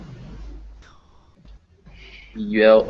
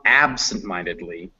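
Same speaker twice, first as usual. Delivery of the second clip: "absent-mindedly" with the stress wrongly on AB; ABsent-mindedly, the rest of "absent-mindedly" unstressed; 4 semitones lower, 4 dB quieter than usual. Shown as a demonstration. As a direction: punch the first syllable of "absent-mindedly". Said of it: "So this syllable is the stressed one".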